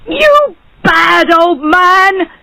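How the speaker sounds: high, drawn-out accusing shout; angry shout. A narrator's reading. Barber accusing the judge, shouting. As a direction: stretch the insult out, high and outraged. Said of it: "shouted the barber".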